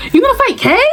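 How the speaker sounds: imitated high-pitched, eager question; excited nosy friend. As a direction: ask it high, like spreading gossip.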